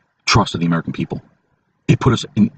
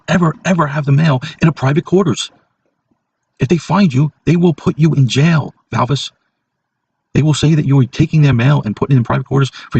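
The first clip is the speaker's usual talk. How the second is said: much higher, hammed-up scolding; imitating insistent coworker; 5 semitones higher than usual. Coworker Corey insisting on the mail rule, mock shrill scolding. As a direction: squeak out the coworker's stern insistence.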